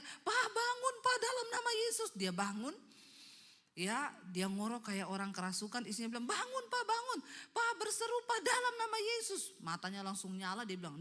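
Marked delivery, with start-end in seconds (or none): 0.0-2.1 very high, urgent imitated cry; wife's scream. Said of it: "Istrinya teriak". 6.3-9.5 very high, urgent acted plea; wife crying out. Istri membangunkan suami yang kerasukan.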